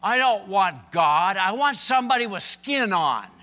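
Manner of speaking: imitating a child's loud, high protest; acted little girl pleading at full volume; insistent little girl. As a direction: protest to Daddy like a scared child. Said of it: "and she said".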